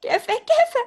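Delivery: laughing question. Acted. Sister laughing.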